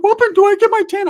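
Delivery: put-on way up high, squeaky little kid; high-pitched kid pleading. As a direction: squeak it like a small boy. Kid Ricky asks in high voice.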